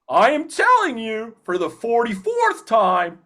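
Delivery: high, slow cartoon voice cracking into a laugh; [name]'s voice. Actor retries the line, laughing in high cartoon voice.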